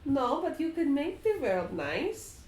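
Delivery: put-on a boy offering a bright idea; little Strahd. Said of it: "Little Strahd says".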